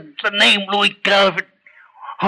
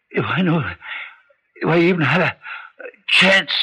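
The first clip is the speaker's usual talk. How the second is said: ordinary talk, nothing marked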